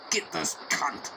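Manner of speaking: lightly voiced man setting a dog loose; urging the dog. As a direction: egg the dog on in a mock mutter.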